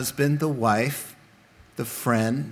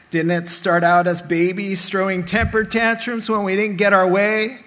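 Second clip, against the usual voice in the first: fiery preaching; 3 semitones higher, 4 dB louder than usual. Acted. Preaching at full force on tantrums.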